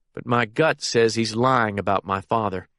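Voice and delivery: plain reading voice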